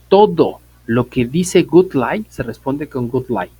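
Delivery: teacher slowly stressing the key rule; slow emphatic rule. Real, not acted. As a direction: drive the rule home word by word. Teacher hammering the main rule.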